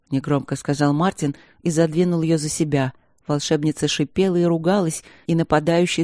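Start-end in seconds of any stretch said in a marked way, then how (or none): none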